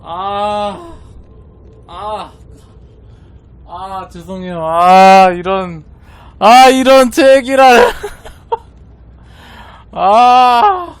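high, loud wails of despair; anguished groaning. Real, not acted. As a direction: groan over the loss. Groaning over failed craft.

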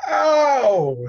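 sudden high, loud pained cry. Acted. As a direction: yelp as the venom strikes. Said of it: "he cried".

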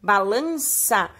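"Balança" slow and stretched; sounded-out word. Shown as a demonstration. Teacher repeats the new word to pupils, sounded-out. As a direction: draw out each syllable of "Balança".

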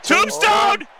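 high shouted finisher call; fired-up commentator shout; excited play-by-play. Real, not acted. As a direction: yell the big move. Calling a finishing move.

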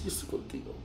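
whispered comfort, very soft; retold words of comfort, barely voiced; hushed consoling. Wife consoles him in the retelling. (consuelo susurrado, muy bajito)

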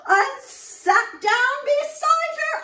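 very high, loud frightened cry; hammed-up fright. Storyteller hamming Miss Muffet's fright for children, high and loud. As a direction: play the scare big for the kids.

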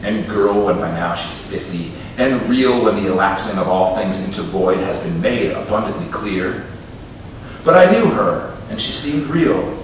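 measured, lilting poem recital; poetry reading. Acted. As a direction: read it like a poet at a reading. Poet reads her poem.

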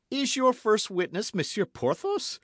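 high, loud incredulous question; astonished. Acted. D'Artagnan questions Athos about his first witness, astonished. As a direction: ask it in high-pitched disbelief.

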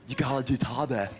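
lightly voiced bro friend; a hint of a laid-back dude; mild imitation of how his friends talk; friend's talk. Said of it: "my friends talk like that".

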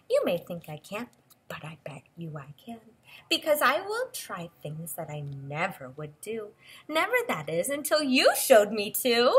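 lilting storybook read-aloud; expressive picture-book voice; reading to children. Acted. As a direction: read the book's lines to a little listener.

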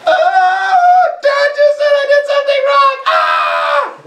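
put-on high, whining kid voice with a yelp; mock a toddler throwing a fit; whiny toddler. Mocking a whiny upset toddler.

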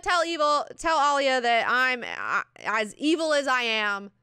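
loud, high, drawn-out retort; acted defiance. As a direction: send back a hammed-up message, slowly. Acts out Jessica's defiant retort.

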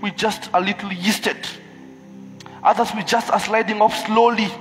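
fervent high loud sermon; preaching. Acted. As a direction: preach it out with full force. Preaching at full force.

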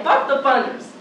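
normal talking voice

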